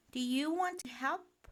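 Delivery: put-on high, eager little boy's question; inviting child. A narrator's reading. Boy inviting friends to help, eager. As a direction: offer hopefully, lifting the pitch.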